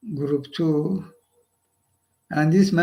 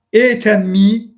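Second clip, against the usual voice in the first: forceful quote; 6 dB louder, slower than usual. Retold or imitated. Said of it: "what he's saying?".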